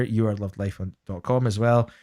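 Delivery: ordinary talk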